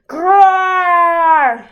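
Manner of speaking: bear growl. A narrator's reading.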